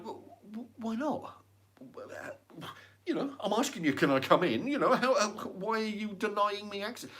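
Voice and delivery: high, pushy, half laughing; indignant inspector. Retold or imitated. Inspector refused entry at the door, mock indignant pleading. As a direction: ham up an offended official. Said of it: "they're like".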